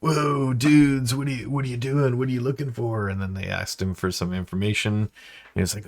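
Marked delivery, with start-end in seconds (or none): 0.0-3.1 high laid-back surfer dude; dude type voice. Retold or imitated. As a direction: stoner dude, higher pitched. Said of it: "his voice was sort of like".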